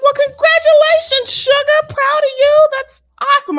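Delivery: high, loud, sugary fake cheer; mock congratulations. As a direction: gush it sky-high and sarcastic.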